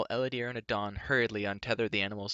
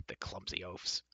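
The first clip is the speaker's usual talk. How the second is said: much softer grumble under the breath; muttered; 3 semitones lower, 10 dB quieter than usual. A narrator's reading. Dolly grumbling about the guards, much softer. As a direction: grumble it aside. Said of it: "Dolly muttered".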